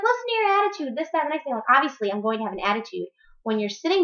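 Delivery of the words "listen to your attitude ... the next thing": imitating her mother's tearful whine, higher; a mild, hammed-up teary scold; mock crying mother. Mocking her crying mother scolding her attitude, in a higher, teary whine. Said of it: "she starts crying because she's like".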